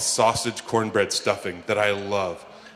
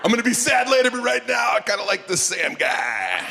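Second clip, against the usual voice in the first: hammed-up crowd cheering, much higher and louder; mock excited cheer; 12 semitones higher, 3 dB louder than usual. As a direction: play sad people suddenly thrilled. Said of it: "They're like".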